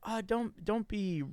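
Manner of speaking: timid coworker imitation. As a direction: lightly mimic a nervous colleague. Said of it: "one of our younger ones is like".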